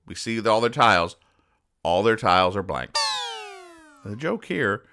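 ordinary delivery

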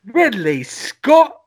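high, slow Cockney call; drawn-out Cockney street cry; Cockney accent. Pretend. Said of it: "also have Cockney accents".

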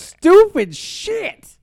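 loud, high, drawn-out exasperation; high-pitched, slow, fed-up yell; exasperated outburst. Real, not acted. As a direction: draw it out slowly in loud disgust. Exasperated outburst over the show.

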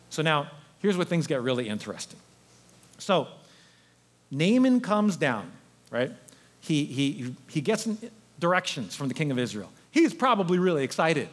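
ordinary delivery

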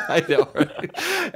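laughing, squeaky right. Real, not acted. To co-host about the rep, laughing. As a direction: crack up mid-sentence.

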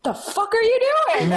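high-pitched, drawn-out shocked outburst through laughter; upset disbelief. Retold or imitated. Woman retelling her reaction to the ring, shocked and upset. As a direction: reenact being floored, voice shooting way up. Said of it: "I was not well. I was very upset".

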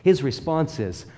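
ordinary talk

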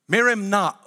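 normal talking voice

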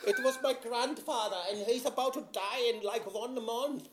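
high, loud mock protest; defensive German voice. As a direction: whine it like an indignant German.

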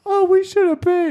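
high-pitched mock girlfriend voice; mimicked date. Storyteller mocking his date's objection in a high voice.

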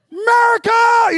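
very high, very loud, slow triumphant roar; cheering shout. Retold or imitated. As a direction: bellow it like you're on your feet in the cinema. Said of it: "I'm like".

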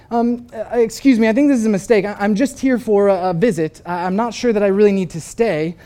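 nervous stammer. Retold or imitated. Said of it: "the man starts to say".

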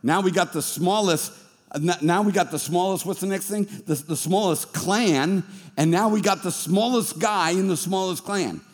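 normal talking voice

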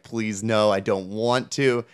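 normal talking voice

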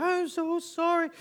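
put-on high, slow little-girl apology; young daughter apologizing through tears, high and slow; tearful child. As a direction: voice a small child about to cry. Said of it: "she welled up with tears".

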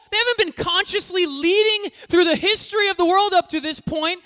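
loud, high, outraged protest as Peter; indignant Peter. Retold or imitated. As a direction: play Peter, offended and loud, rejecting the newcomers. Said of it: "to those that he said".

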